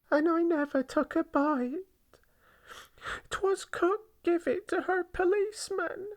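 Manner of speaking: high-pitched plea of innocence; protesting child. A narrator's reading. Wronged girl insisting she took nothing, high protest.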